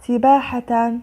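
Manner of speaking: سباحة read with a final -tan; tanween fath. Shown as a demonstration. Teacher reading out the word list, tanween fath on سباحة. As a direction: sound out سباحة as "sibaahatan". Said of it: "فتح".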